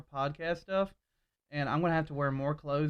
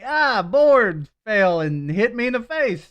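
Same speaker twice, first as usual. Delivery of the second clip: loud, high, slow made-up excuse; cover-up excuse; 6 semitones higher, 9 dB louder, slower than usual. Retold or imitated. Acting out the lie to coworkers, overplayed excuse. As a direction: drag out an unconvincing alibi. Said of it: "And I'm like".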